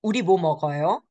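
"먹어요" rising at the end as a question; asking. Acted. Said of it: "Linda, um, asks".

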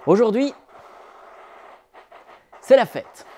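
loud, high joyful opening; festive announce. A narrator's reading. Narrator opening the festive story to children, excited. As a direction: announce the party.

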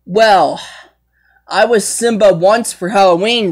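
ordinary talk

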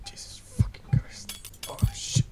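breathy whispered swearing; whispered curse. Real, not acted. Surgeon whispering a curse at his failing hands. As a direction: hiss it under your breath.

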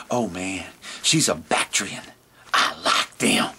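low, soft camel, fading to a whisper; dreamy camel murmuring low. Acted. Camel describes girlfriend.

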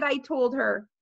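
plain reading voice

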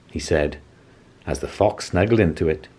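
ordinary delivery, nothing marked

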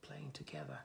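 whispered aside; soft storytime whisper. Real, not acted. Soft aside beside the book.

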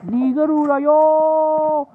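very high, loud, stretched-out yell; full-volume high holler; drawn-out shout. Real, not acted. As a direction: belt it out, holding the vowels long. Calling out during game play.

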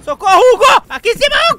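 loud panicked call for help; shouting for help. Real, not acted. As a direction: yell up to your teammate. Calling teammate for help.

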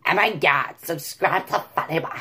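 mock California Valley girl accent; gushy Valley girl, trailing into creaky softness. Shown as a demonstration. Vlogger playing a California girl plugging him, Valley girl accent. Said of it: "Valley girl, California girl".

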